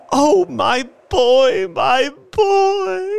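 loud, very high, drawn-out cry; grief-stricken wail. A narrator's reading. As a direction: wail for a lost child. Mother grieving her dead son.

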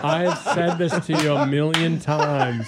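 laughing through the words; talking while laughing. Real, not acted. Teasing co-host about old joke.

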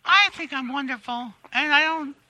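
self-satisfied celebrity impression, pitched above own voice; Kathy Griffin. Celebrity answering interviewer about herself, in a self-satisfied impression. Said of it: "Hey, Kathy".

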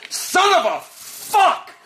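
very high, slow, drawn-out scream; ecstatic screaming curse. Pretend. Imagined winner screaming curses, very high and slow. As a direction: wail the curse at the top of your voice. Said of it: "You're like".

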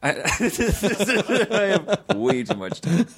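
real laughter through the words; high, breaking up while admitting it; laughing. Real, not acted. Cracks up admitting it.

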